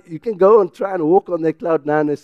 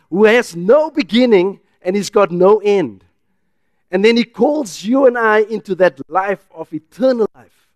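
loud, raised preacher's delivery; fervent preaching; 6 semitones higher, 7 dB louder than usual. Acted. Preacher exalting the eternal God to a congregation, fervent and loud. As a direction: proclaim it with punched key words.

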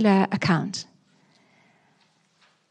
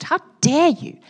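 raised, indignant demand; accusing God; 7 semitones higher than usual. Retold or imitated. Believer confronting God in anger, raised and indignant. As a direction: throw the question at God.

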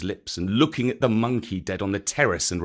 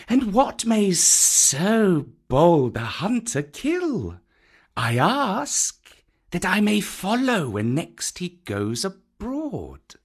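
higher, slow mock-respectful snake; teasing python; 7 semitones higher, slower than usual. A narrator's reading. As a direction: gently tease the little hunter. Kaa speaking.

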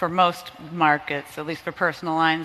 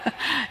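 laugh; 11 semitones higher than usual. Real, not acted. Laughs at teasing remark.

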